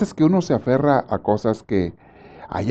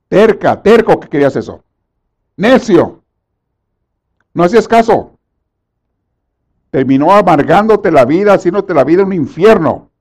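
loud, high rebuke; loud scolding of a stubborn believer; scolding preacher; 5 semitones higher, 6 dB louder than usual. Acted. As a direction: scold the stubborn sinner hard.

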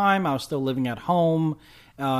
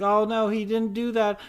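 higher fretting mom voice; worried mother; 7 semitones higher, 3 dB louder than usual. Retold or imitated. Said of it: "to which they're like".

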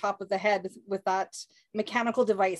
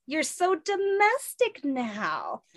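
high, slow teasing drawl; playful mock-sweet tease; teasing girl voice; 6 semitones higher, much slower than usual. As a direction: stretch it out to needle him. [name] teasing in comic read.

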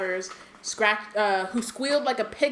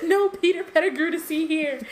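high, loud playful squeak; hammed-up rat pleading innocence; mock innocent rat; 8 semitones higher, 5 dB louder than usual. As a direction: wave them off like a sly rat. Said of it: "rat voice continued".

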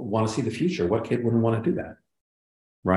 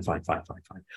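whispered resignation; 6 semitones lower, 10 dB quieter than usual. Real, not acted. Writer settling on Hannah Montana, giving up in a whisper. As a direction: whisper it under your breath, much softer.

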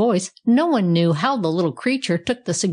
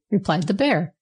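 usual speaking voice

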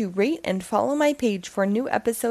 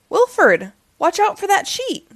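calls out a warning, higher; 9 semitones higher, 5 dB louder than usual. Acted. Blue warns Wilford about the sheet, calling out. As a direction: shout a quick warning to a friend.

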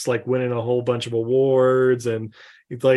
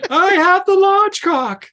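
high, slow, loud mock boast; mock Anakin; 7 dB louder, slower than usual. Mocking Anakin line.

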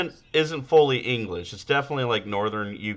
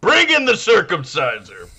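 movie tough guy; 5 semitones higher, 5 dB louder than usual. Acted. Podcaster acting out an interrogation scene, loud tough-guy voice. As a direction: call for your enforcer with mock menace.

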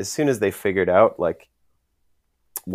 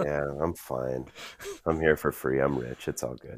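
mock smug Drama; 4 semitones lower, 5 dB quieter than usual. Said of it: "Drama quoted by co-host".

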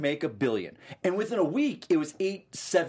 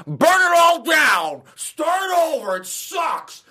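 high, slow, loud fan rant; angry fan rant; 11 semitones higher, 6 dB louder, much slower than usual. Retold or imitated. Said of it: "everyone's like".

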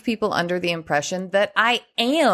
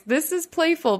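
usual speaking voice

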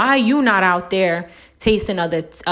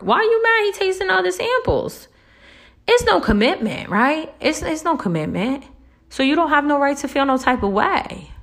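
high, mocking sarcastic scolding; mock-innocent sarcasm, raised pitch; 8 semitones higher than usual. Real, not acted. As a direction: needle them in a high voice. Sarcastic lecture at listener.

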